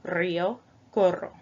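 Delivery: río and corro with a trilled R; rolled R. Shown as a demonstration. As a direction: roll the R in corro hard. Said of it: "you roll your R".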